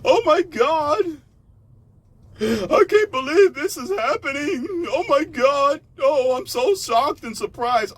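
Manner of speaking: very high, loud mocking gasp of shock. Mocking the listeners who act surprised, high and hammed-up. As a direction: ham up a fake shocked bystander. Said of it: "sit back like a dumbass and".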